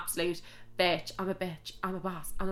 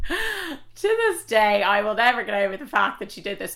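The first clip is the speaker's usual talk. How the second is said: laughing outrage; 2 semitones higher, 4 dB louder than usual. Real, not acted.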